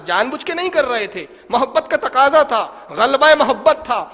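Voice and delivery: loud, ringing sermon delivery; fervent preaching. Acted. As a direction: preach it out to the crowd. Preaching climax.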